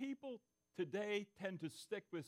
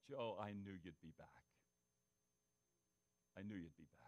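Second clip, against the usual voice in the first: low, hushed dying man's voice, much softer; soft dying words; 10 semitones lower, 8 dB quieter than usual. Retold or imitated. Dying friend whispering to the rescuer, hushed and low. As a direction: breathe it out like a dying soldier.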